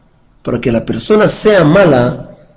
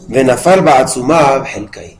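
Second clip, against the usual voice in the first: brisk, steady reading of Hebrew verse; psalm recitation; 2 semitones lower, faster than usual. Acted. Teacher reading the Tehilim verses to the class, brisk recitation. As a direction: run through the verses quickly, like a familiar text.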